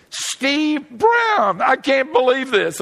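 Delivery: high, loud delighted cry of a name; excited recognition. Retold or imitated. Receptionist recognizing the famous preacher, high excited cry. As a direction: squeal it like you can't believe who walked in.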